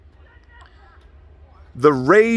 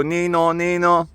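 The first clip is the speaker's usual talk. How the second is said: sing-song mock siren, held notes; ambulance siren imitation; 4 semitones higher than usual. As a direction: imitate an ambulance's nee-naw. Imitates siren after "when they go.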